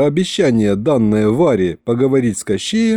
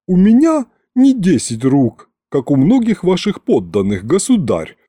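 high-started, testy servant's comeback; snapped retort; 6 semitones higher than usual. A narrator's reading. Butler answers the king's complaints, testy retort. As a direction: hit the opening high, then settle into sarcasm. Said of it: "огрызнулся Гюнтер".

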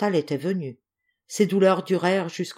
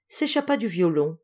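usual speaking voice

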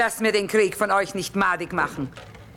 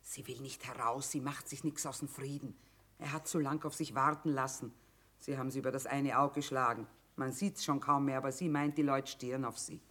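lowered voice; 5 semitones lower, 10 dB quieter than usual. Acted. Confiding about daughter within earshot.

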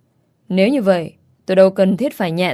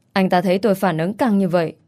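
usual speaking voice

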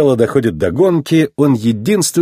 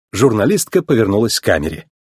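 usual speaking voice

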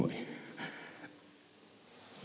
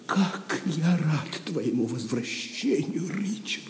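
tender welcome; 5 semitones higher, 5 dB quieter, slower than usual. Acted. Встреча с вернувшимся королём.

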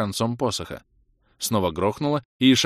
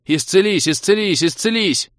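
high, loud, insistent repeated cry; wound-up rattling; 11 semitones higher, 8 dB louder than usual. A narrator's reading. Healer frantically chanting over the dying elf, wound-up and loud. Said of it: "Как заведённый затараторил док".

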